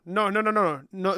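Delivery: normal talking voice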